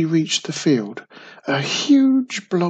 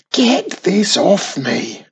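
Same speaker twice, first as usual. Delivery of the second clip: higher, louder, slow complaint; complaining; 5 semitones higher, 5 dB louder, slower than usual. Acted. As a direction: whine to get the slime off. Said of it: "Wizardy Witch complained".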